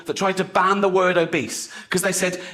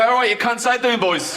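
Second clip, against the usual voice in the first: raised-pitch, hammed-up working-class British greeting; working-class builder voice; 6 semitones higher than usual. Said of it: "act more working class around builders".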